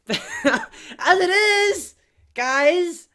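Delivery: very high, slow, drawn-out announcing voice; hammed-up sign-off. Signing off to listeners, hammed-up. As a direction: stretch it out in a silly high call.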